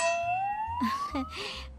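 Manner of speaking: high, shy bride giggle; coy giggle. Acted. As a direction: giggle playfully before answering. Bride giggles at father-in-law. (दुल्हन की ऊँची शर्मीली हँसी)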